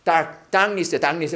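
"darkness" as "당니스", K swallowed into the N; like Korean 닭. Shown as a demonstration. Teacher compares it to 닭 for students, silent K. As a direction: say "dark" like 닭, then glide into "ness". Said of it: "약간 닭이라고 해".

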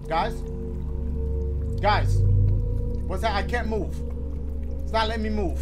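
loud panicked calls; panic, unable to move; scared horror game streamer. Real, not acted.

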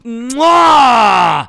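big exaggerated kiss sound; loud smooch. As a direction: smack a loud mock kiss. Pretend dad gives a kiss.

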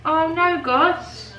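oops. Real, not acted. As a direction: caught off guard by the spill. Dropped bag, dog nearby.

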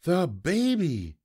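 high, drawn-out cry of alarm; worried sibling calling out, not full volume; mild yell. A narrator's reading. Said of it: "I yelled louder than anyone".

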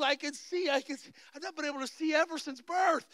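high-pitched retold wonder; imitated amazement. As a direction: play the newly sighted youth's astonishment. Retold youth discovering he can see.

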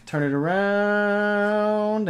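very slow, high held "around"; drawn-out flip. Real, not acted.

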